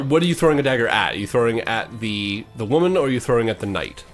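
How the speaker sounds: ordinary talk, nothing marked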